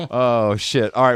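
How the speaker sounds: ordinary talk, nothing marked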